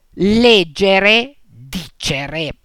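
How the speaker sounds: leggere, dicere hit on the first of three syllables; LEG-ge-re, DI-ce-re; stress on antepenultimate syllable. Shown as a demonstration. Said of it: "Sono invece accentate sulla terzultima".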